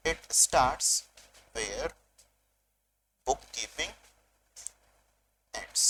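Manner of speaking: word by word, long pauses between; dictation. Shown as a demonstration. Teacher repeats the definition for students to write, dictation. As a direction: dictate it slowly in separate pieces for note-takers. Repeats the sentence for note-taking.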